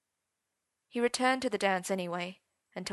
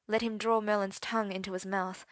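ordinary delivery

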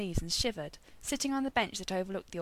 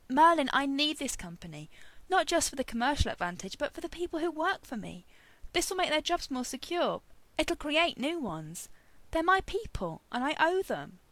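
higher-pitched, impassioned pleading; earnest appeal; 7 semitones higher than usual. A narrator's reading. Arthur pleading with Merlin for his company, earnest. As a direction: argue it with heartfelt urgency.

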